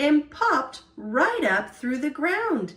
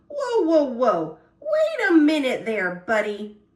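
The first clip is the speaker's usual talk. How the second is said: alarmed shout; 2 semitones higher, 4 dB louder than usual. Acted. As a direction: yell at the squirrel to stop. Acorn being nibbled by squirrel.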